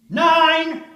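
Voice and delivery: far-off officer's shout; shouted count. Acted. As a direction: bellow the number down the line. Countdown called in trench.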